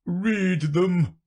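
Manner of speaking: forceful king's command. Acted. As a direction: order it as a storybook king. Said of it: "said the king".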